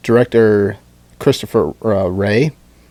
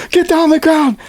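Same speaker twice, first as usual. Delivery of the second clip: high shouted police command; police yelling. Retold or imitated. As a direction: bark it like a cop on bodycam.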